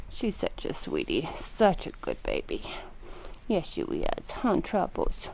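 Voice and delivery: low soft coo. Real, not acted. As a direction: murmur lovingly to pet. Cooing to pet.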